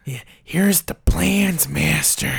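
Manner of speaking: slow, creepy henchman voice; old-movie minion impression; Peter Lorre. Shown as a demonstration. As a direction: drag it out like a servile film henchman. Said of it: "I can do Peter Lorre".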